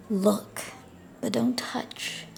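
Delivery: plain reading voice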